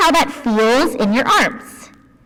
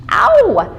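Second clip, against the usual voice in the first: mock ow; 8 semitones higher, 3 dB louder than usual. Acting out a pinch.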